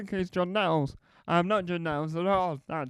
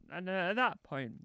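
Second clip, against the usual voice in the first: ordinary talk, nothing marked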